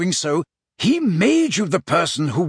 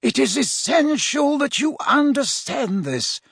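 higher-pitched, urgent insistence; acted mentor, earnest and worked up; agitated; 11 semitones higher, slower than usual. A narrator's reading. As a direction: make the pupil grasp it. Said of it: "never seen him so agitated".

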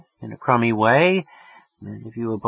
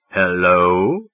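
put-on deepened voice, trying to sound older; deep put-on voice; 4 semitones lower than usual. A narrator's reading. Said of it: "I made my voice quite deep".

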